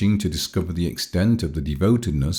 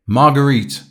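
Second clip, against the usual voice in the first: a raised, urgent cry to stop her; 8 semitones higher, 5 dB louder than usual. A narrator's reading. Paul calls to Marguerite as she leaves, crying out. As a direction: call the name out loud and high. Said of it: "he cried aloud".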